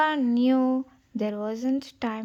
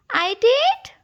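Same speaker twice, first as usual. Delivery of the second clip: much higher, louder amazed question; surprised; 12 semitones higher, 8 dB louder than usual. Acted. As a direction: cub can't believe his father's praise. Simba surprised.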